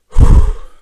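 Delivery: loud exhale; steadying breath out. Acted. Child braces to answer God, loud exhale. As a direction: blow out a bracing breath.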